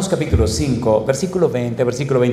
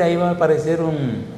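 usual speaking voice

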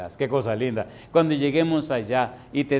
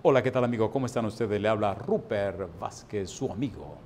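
lower, smooth announcer voice introducing himself; radio announcer; 6 semitones lower, 5 dB quieter than usual. Retold or imitated. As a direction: play the suave radio host, pitched low. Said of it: "oye cómo suena". (voz de locutor de radio, más grave y melosa)

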